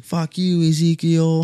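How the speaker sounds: high, strained mock retort in a put-on voice; mocking the angry golfer. Golfer mimics the angry stranger cursing him out, high mocking voice.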